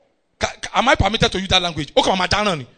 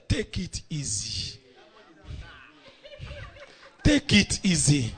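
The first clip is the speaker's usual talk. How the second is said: low, soft, drawn-out reassurance; unbothered calming; 3 semitones lower, 6 dB quieter, slower than usual. Acted. Rich man's son to angry onlookers, unbothered calming. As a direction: settle them down quietly, in no hurry. Laughing boy calms the screamers.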